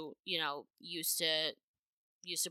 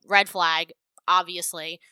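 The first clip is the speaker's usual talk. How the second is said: loud emphatic aside; 9 dB louder, slower than usual. Real, not acted.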